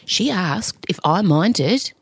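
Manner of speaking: normal talking voice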